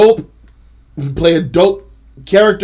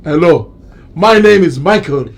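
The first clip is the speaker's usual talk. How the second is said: mock African accent, emphatic and comic; 2 semitones higher, 4 dB louder than usual. Third man in the joke lineup, mock African accent. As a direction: play another man introducing himself with an African accent. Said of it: "Speaking in English with the accent".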